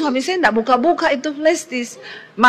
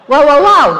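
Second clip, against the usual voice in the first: amazed wow; 3 semitones higher, 7 dB louder than usual. Real, not acted. Preacher prompting the congregation to marvel, loud wows. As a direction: lead the crowd in a big wow. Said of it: "Kok ndak wow, ya?".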